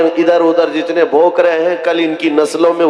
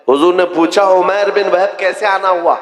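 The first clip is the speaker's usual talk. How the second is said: ordinary talk, nothing marked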